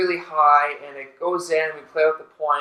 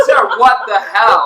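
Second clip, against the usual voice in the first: retold exasperated outcry; 4 semitones higher, 7 dB louder than usual. Retold confronting Sarah afterwards.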